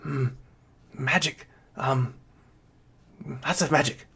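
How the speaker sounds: soft, hesitant little kobold voice; small creature pondering, kept quiet. Acted. Game master as kobold looking about, soft little creature voice. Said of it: "And the little kobold here".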